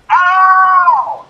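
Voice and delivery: loud high mock ow; high, loud kid's ow; retold cry of pain. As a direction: yelp like a kid slammed into nails. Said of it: "We were crying".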